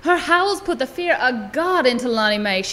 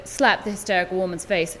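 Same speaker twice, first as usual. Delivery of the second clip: usual speaking voice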